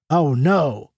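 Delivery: plain reading voice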